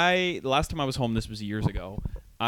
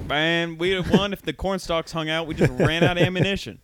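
mock Southern accent; 6 semitones higher, 6 dB louder than usual. Asked about the Civil War while drunk, hammed-up Southern accent. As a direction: ham up a drunk Southern soldier.

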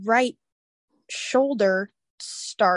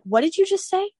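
imitated alarm, pitched very high; high, startled retold question without shouting; freaking out; 11 semitones higher than usual. Mom hearing her daughter passed out, freaking out. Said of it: "She was freaking out".

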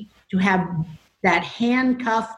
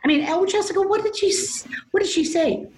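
alarmed mom; 11 semitones higher than usual. Retold or imitated. Mom panics at daughter's story.

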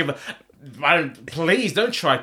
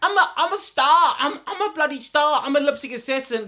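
high-pitched mock boasting; overconfident diva; 7 semitones higher than usual. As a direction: parody a star bragging about herself. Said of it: "she is the most overly confident person".

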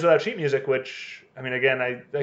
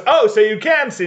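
loud, raised, accusing exclamation; mock gotcha; 7 semitones higher, 7 dB louder than usual. Co-host acting a woman exposing a faker, mock gotcha. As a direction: pounce on the discovery.

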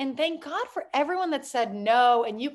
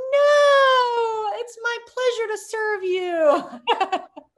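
much higher, much louder no, then a slow laughing pleasure to serve you; hammed-up servile politeness; 11 semitones higher, 8 dB louder, much slower than usual. Mocking her past waitress self refusing a tip, loud, slow and laughing. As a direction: overplay the gushing waitress, laughing through it.

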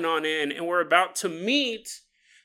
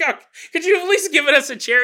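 high, fast whining student; whiny student; 7 semitones higher, 6 dB louder, faster than usual. Acted. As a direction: complain like a pupil. Students complaining.

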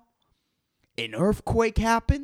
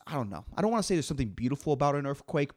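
normal talking voice

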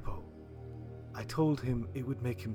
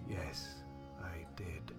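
low, very soft admission; grave, subdued murmur; hushed confession; 5 semitones lower, 9 dB quieter, slower than usual. Acted. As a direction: confess it quietly, voice dropped. Doctor confesses in the story.

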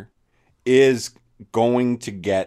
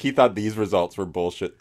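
ordinary talk, nothing marked

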